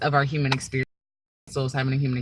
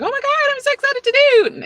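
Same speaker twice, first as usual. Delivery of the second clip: shrieked very high and louder; giddy excitement; 8 dB louder than usual. Shown as a demonstration. Explaining true excitement to viewers, a mock squealing gush. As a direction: gush as if you can barely contain it. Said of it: "It's not what was the most thing".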